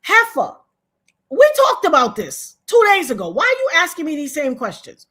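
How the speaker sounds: high, loud exasperated comeback; indignant, punched-up scolding; indignant retort. Retold or imitated. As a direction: call out a friend with sass. Acting out her indignant retort.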